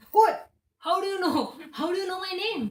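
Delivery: sudden high, loud What then stunned questions; startled thief. Acted. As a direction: jolt of shock at hearing his name.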